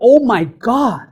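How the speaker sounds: an exasperated "Oh my God" at the driver; mock road annoyance; exasperated groan. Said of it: "you say".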